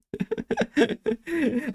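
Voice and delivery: long, high giggling laugh; high giggle. Real, not acted. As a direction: giggle helplessly, high in pitch. Laughing about own accent.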